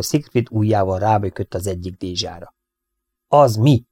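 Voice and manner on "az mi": short, mildly sharp question; a touch of a bark. A narrator's reading. Siegfried spots something in the milk pail, barking lightly.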